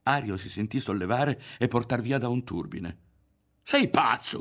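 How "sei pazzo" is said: high, loud outraged cry; furious raised-voice outburst; shouted. A narrator's reading. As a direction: yell it in disbelief. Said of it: "gridò".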